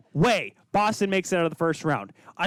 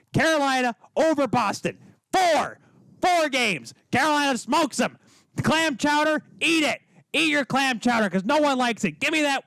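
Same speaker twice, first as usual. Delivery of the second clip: hyped up; 6 semitones higher than usual. Real, not acted. Host hyping Carolina's sweep over Boston fans. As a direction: pump up the crowd. Fired-up hockey prediction rant.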